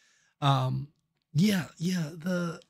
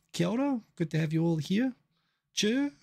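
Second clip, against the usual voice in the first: higher-pitched New Zealand hello; Kiwi greeting; 6 semitones higher than usual. Shown as a demonstration. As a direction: toss out Kiwi-isms in a New Zealand lilt. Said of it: "throw in some Kiwiisms".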